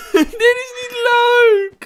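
high, slow, loud whine of protest; panicked wail. Real, not acted. Player complains mid-scare, panicked wail.

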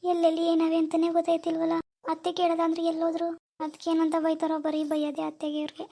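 soft, uneasy muttering; worried self-talk. Acted. New bride afraid to ask mother-in-law, muttering softly. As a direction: fret quietly to yourself. Bride worrying aloud alone in kitchen.

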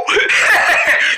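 high, giggly cackle; burst of laughter. Acted. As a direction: crack up at the silly voice. Laughing at the funny voice.

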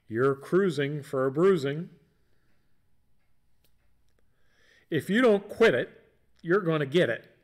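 lightly voiced scolding mom; a hint of a nagging mother; mom lecture. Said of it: "famous moms saying".

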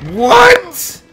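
high, loud disbelieving shout; shocked what. Real, not acted. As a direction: shout it in outrage. Thrown off again.

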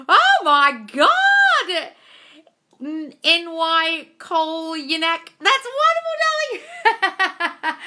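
very high, loud, drawn-out cry of joy; ecstatic shout. Real, not acted. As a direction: scream with joy at the win. Viewer reports contact after six months.

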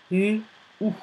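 isolated sounds "U", pause, "ou"; sounded out. Shown as a demonstration. Teacher spelling clou for pupils, sounded out. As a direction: say each sound of clou on its own. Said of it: "j'entends L et j'entends OU".

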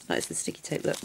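breathy whispered aside to herself; hushed, unvoiced murmur. Real, not acted. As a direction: drop to a whisper, just for yourself. Whispered aside about sticky tape.

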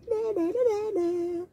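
nyah-nyah singsong; teasing sing-song. Acted. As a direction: tease the bear like a naughty child. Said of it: "He's sticking his tongue out".

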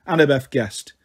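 ordinary delivery, nothing marked

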